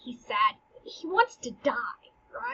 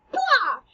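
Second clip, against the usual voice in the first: high loud mock blah burst; 10 semitones higher, 5 dB louder than usual. Teacher acting the tomb spitting out the ghost, mock burst.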